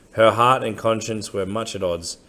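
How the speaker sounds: usual speaking voice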